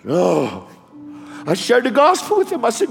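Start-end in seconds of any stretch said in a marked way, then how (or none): 0.0-0.7 dying man. Retold or imitated. Voices gurgling, dying crash victim.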